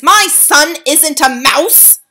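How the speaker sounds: slow, loud, high protest; shouting. A narrator's reading. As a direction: hammer each word out. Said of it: "shouted Mister Jenkins".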